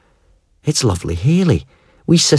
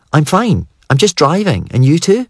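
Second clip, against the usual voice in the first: much higher, breezy woman's voice; bright fake casual; 9 semitones higher than usual. A narrator's reading. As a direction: play it cool and innocent. Sister bluffing her brothers.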